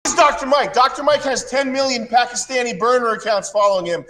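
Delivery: high-pitched, worked-up tirade; indignant rant building up; heated rant. Real, not acted. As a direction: rant in disbelief, pitched well up. Worked-up rant mocking a rival.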